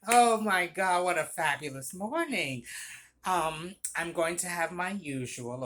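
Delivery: gushing theatrical regular, easing into a grand order; flamboyant customer. Retold or imitated. Regular greets two baristas opening the store, flamboyantly. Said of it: "he would say it like that every time".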